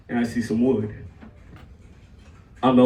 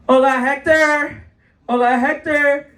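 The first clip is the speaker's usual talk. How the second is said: lightly voiced old lady, higher pitched; old lady calling out; 6 semitones higher, 4 dB louder than usual. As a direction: call out a greeting like an old lady. Old lady calls him by wrong name.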